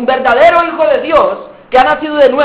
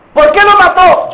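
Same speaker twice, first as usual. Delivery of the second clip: interrogating judge; 6 semitones higher, 5 dB louder than usual. Acted. Trial questioning the accused.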